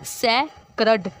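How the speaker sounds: सैक्रड stretched slowly, सै then क्रड; slow syllable demo. Shown as a demonstration. Teacher joining the syllables for students, slowly. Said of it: "पहले हमने सै बोला".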